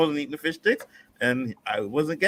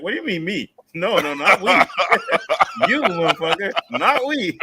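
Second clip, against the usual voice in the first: laughing mock protest; 6 semitones higher, 6 dB louder than usual. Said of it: "he's like".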